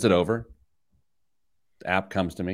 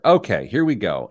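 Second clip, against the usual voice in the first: ordinary talk, nothing marked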